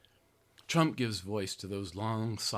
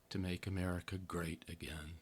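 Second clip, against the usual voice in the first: mock whisper; 4 semitones lower, 9 dB quieter than usual. Mocking slogan after building list.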